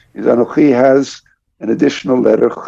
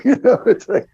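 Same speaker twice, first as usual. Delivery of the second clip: laughing; 11 semitones higher than usual. Real, not acted. Laughing at the idea.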